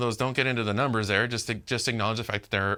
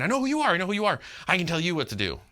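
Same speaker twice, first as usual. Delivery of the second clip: high, taunting demon voice; demon boasting; 7 semitones higher, much faster than usual. Retold or imitated. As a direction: sneer it up high like a cocky demon. Demon trying to get the upper hand.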